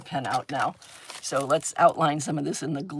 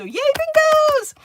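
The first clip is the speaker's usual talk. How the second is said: loud, high drawn-out hooray; excited cheer; 8 dB louder, much slower than usual. Real, not acted. Host celebrating the viewers' bingo wins, excited cheer.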